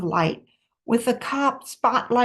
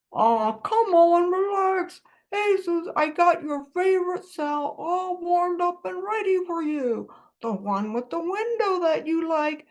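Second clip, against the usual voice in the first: higher, coaxing call; cheerful shouting cop; 4 semitones higher than usual. A narrator's reading. Cop coaxes fugitive down toward a cell, cheerful calling. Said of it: "Mug shouted cheerful words".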